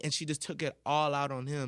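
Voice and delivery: ordinary talk, nothing marked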